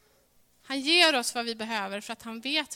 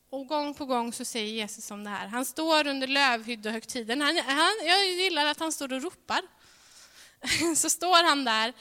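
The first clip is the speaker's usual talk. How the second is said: usual speaking voice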